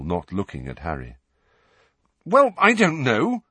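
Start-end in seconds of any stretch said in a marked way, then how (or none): 2.3-3.4 higher and louder, exasperated; rather angrily. Acted. Harry answers the headmaster, higher and angry. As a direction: snap it out, irritated. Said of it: "said Harry rather angrily".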